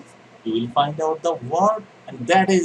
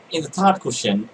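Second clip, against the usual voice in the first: ordinary talk, nothing marked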